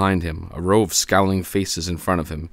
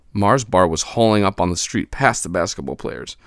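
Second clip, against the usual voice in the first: plain reading voice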